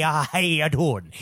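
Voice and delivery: put-on hammy Irish accent, selling it too hard; overdone Irish accent. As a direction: lay the Irish accent on thick. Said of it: "you flip on that Irish accent".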